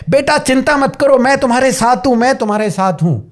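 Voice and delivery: high-pitched anxious pleading, acted; frightened, panicky. Shown as a demonstration. Terrified mother to her child, high and panicky. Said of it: "वो पूरी तरह डरी हुई है, आंखों में आंसू आ रहे हैं, कांप रही है".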